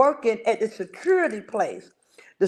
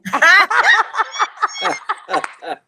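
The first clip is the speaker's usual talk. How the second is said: hearty laugh; 6 semitones higher, 5 dB louder than usual. Real, not acted. Guest laughs at age.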